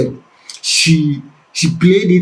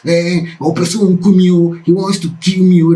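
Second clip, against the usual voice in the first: mock frightened cry, a little higher; retold panicked shouting; 5 semitones higher than usual. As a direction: cry out for help in a retelling. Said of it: "Ran away shouting".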